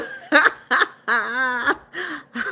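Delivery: high burst of real laughter; hearty laugh. Real, not acted. Streamer reacting to a viewer's giraffe secret, hearty laugh. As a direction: crack up, long and high.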